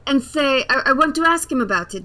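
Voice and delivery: ordinary talk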